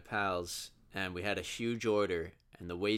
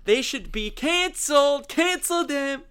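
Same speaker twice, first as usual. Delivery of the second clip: high, loud whiny rant; mock outraged tweeter; 9 dB louder than usual. Mocking an online outrage poster, whiny rant. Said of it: "and say".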